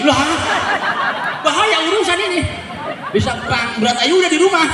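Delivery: high, loud, fast panicked protest; alarmed Kiai. Acted. Kiai loudly panicking that his wife will start a war at home.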